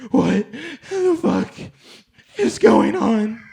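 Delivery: high, slow, drawn-out panicked shout; a frantic father bursting in; hammed-up shock, stretched out and loud; panicked yell. Dad bursts into the room.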